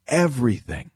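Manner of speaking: ordinary delivery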